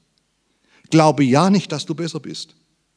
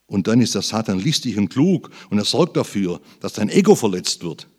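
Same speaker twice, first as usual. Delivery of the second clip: usual speaking voice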